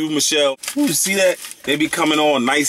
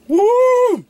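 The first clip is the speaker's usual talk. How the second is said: high, loud falsetto whoop; excited whoop; 6 dB louder than usual. Real, not acted. Showing limited edition cookies, a loud high whoop. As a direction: shriek a joyful woo. Tasting lemon chocolate cookie.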